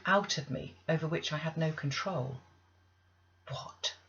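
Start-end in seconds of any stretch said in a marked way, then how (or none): 3.5-3.9 soft, startled what; barely voiced, taken aback; hushed, much softer gasp of a question; under her breath. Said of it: "said Missus Reed under her breath".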